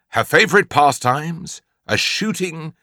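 ordinary delivery

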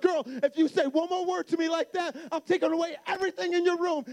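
high, loud, rapid-fire retold threat; mad dad. Dad threatens to take her things, acted-out anger. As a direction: act out a father losing his temper. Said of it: "I got so mad".

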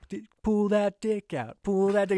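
crew egging on. Retold or imitated. Crew chanting at captain.

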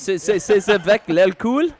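shaky-grammar French with a rising question in a put-on French accent; attempted French. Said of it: "Was that a French accent?".

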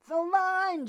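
very loud, high howl. A narrator's reading. Jordan demanding the tow line in the storm, howling. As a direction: howl it over the wind. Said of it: "Jordan howled".

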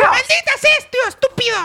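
furious squeaky puppy; very high, loud cartoon shout; Scrappy-Doo. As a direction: curse as an enraged little dog. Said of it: "Oye, Scrappy".

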